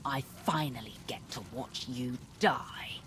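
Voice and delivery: soft, cold whispered threat; hushed promise of death; deadly whisper. Acted. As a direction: breathe the kill into his ear. Killing a long-hated traitor.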